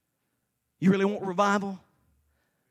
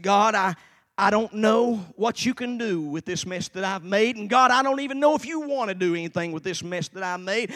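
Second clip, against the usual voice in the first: loud, raised prayer of desperation; doa putus asa yang ditirukan; 3 semitones higher, 7 dB louder than usual. Retold or imitated. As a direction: plead loudly with God, retelling it. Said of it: "I can about quote to you what I said".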